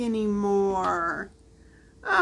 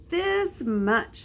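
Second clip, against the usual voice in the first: lightly voiced little hare, high; a small bunny stretching proud; 9 semitones higher than usual. A narrator's reading.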